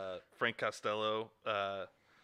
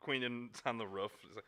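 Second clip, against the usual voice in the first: normal talking voice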